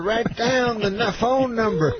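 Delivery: high, very slow, halting mimicry; Bill Cosby. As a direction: stretch each word in a high impression. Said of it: "so he goes".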